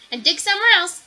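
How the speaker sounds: put-on dog barking at the girl to leave; barked order. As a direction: order her off loudly. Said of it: "barked the dog".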